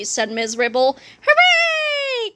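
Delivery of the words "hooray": put-on high, squealing kid cheer; children cheering. A narrator's reading. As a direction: cheer like a classroom of happy kids. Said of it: "cried the children".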